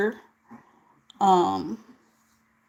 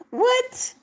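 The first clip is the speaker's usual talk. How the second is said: surprised. Real, not acted. Sudden reaction to chat.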